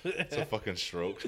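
laughing. Real, not acted. Joking amid group laughter.

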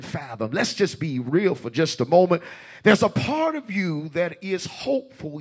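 high, loud preaching build. Acted. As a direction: preach it with rising fervor. Preaching with rising force.

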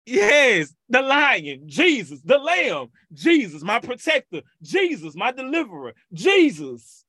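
fiery preacher chant; preaching at full force. Acted. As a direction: call each name of Jesus out to the church. Second litany of titles.

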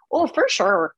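Minnesota accent. Shown as a demonstration. Comic showing her accent warm-up phrase, in Minnesota accent. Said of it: "for Minnesota".

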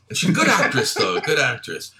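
usual speaking voice

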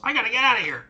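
high, frantic goblin squeal; a cornered goblin bolting in fright; panicked goblin. Acted. Goblin panics as the dwarf nearly tackles him, high squeal.

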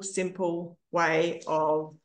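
hoarse, fading voice losing its strength. Real, not acted. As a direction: voice giving out, raspy and thin. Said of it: "Sorry, I'm losing my voice".

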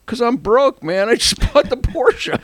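high, exasperated mock protest; laughing protest. Broke buyer answering Steve, high laughing protest. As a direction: pitch it way up, laughing through it.

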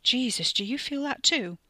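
alarmed question as pain hits. Acted. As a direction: ask in startled pain.

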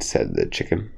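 plain reading voice

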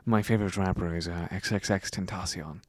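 attempted British accent, tossed off softly; half-hearted British accent, low. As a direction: try a quiet, mock British accent. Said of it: "like, a British accent as well".

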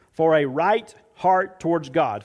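normal talking voice

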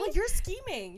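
high, playful accusation; teasing accusation. Real, not acted. Teasing her friend about scheming, high and playful. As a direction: tease him as a schemer. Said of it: "teasing accusation".

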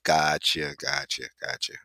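low, soft office acknowledgments. Acted. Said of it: "when they're explaining something".

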